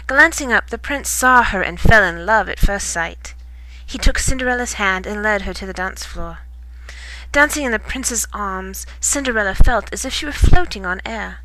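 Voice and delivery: ordinary delivery, nothing marked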